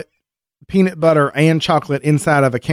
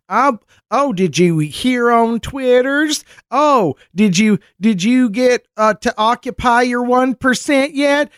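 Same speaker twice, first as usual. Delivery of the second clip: high goofy voice; 10 semitones higher, slower than usual. Retold or imitated. Denny voiced clomping in.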